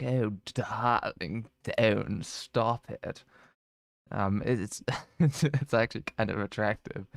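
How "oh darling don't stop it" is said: very slow, drawn-out sultry drawl; Cruella impression. Describing Emma Stone's Cruella, slow sultry drawl. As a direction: imitate Cruella purring each word. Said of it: "her voice is like".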